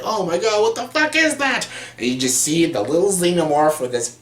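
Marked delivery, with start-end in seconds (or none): 0.0-1.7 much higher, shocked cry of disbelief; mock shocked yelp. Crew reacting to the chestburster, high shocked yelp. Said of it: "Everyone's like".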